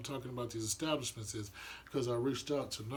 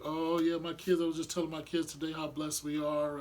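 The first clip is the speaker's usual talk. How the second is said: higher, louder put-on pious sweetness; churchy sugar-sweet voice, higher and louder; mock holy church talk; 5 semitones higher, 4 dB louder than usual. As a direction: play the fake-pious parent at church. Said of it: "you're acting all holy".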